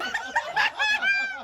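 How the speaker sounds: squeaky high-pitched giggle; amused high laugh. Real, not acted. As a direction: let out a high, breathy laugh. Laughing at own deranged clip.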